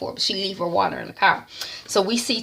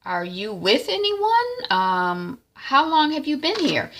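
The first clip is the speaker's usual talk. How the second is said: high concerned stranger; 7 semitones higher, slower than usual. Retold or imitated.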